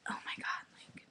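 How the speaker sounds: breathless, voiceless whisper of shock. Retold or imitated. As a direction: mouth it in awe, almost no voice. Retelling her shocked reaction.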